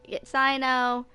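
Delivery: ordinary talk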